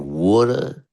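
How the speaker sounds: mimicking a Philadelphia accent, word by word; mock Philly accent with dropped R's; imitated Philadelphia accent. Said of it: "my sister and my mom were saying".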